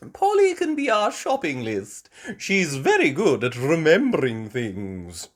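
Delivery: Granny Pig voice. A narrator's reading. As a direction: praise the parrot warmly like a grandmother. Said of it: "Granny Pig said".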